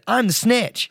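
high-pitched, sing-out announcement; snitch's taunting call. Acted. Player showing off the snitch role to a friend, high playful call. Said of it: "the snitch runs around going".

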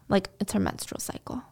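hushed, skeptical aside to the audience; whispered aside. Real, not acted. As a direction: drop to a whisper, doubting the claim.

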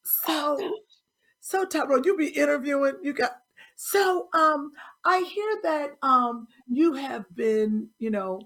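a hint of a host reading a long guest bio; imitating a talk show host. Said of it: "Who talks like that".

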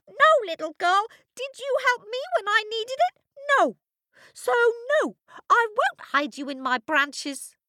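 high quick creaky tree voice. A narrator's reading. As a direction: squeak out the refusal. Apple tree refuses.

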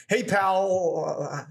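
"pal" stretched on its L, sounding unfinished; long lingering L ending "pal"; held final L. Shown as a demonstration. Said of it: "I just had to continue talking".

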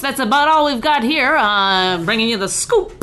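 hammed-up fifties newspaper reporter signing off; fifties newspaperman. Told he looks like a fifties newspaperman, signs off as one, hammed up. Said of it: "you work for a newspaper in the fifties".

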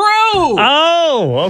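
high startled "oh". Real, not acted. Host startled by co-host's shout, high startled oh. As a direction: jump at the shout.

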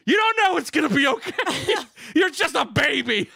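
high, loud, cracking up mid-shout; laughing yell. Retold or imitated. Dad laughing-yelling at his baby son, loud and high. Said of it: "I start going".

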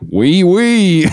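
comic Southern take on French; French with Southern accent. Acted. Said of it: "like, a Southern accent?".

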